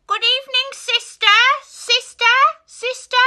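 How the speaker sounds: very high, loud quack-like call; quacking duck. Acted. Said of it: "She quacked".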